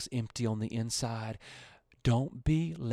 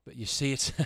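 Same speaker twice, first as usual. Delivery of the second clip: usual speaking voice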